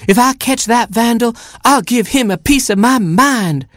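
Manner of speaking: high angry squawk of a hen. Acted. Mother hen threatening the vandal, angry squawk. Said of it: "squawked Mama Hen".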